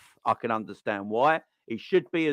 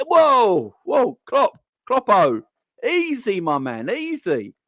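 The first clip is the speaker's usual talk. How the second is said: whoa easy; 9 semitones higher, 5 dB louder, much slower than usual. Acted. Calming an angry Klopp.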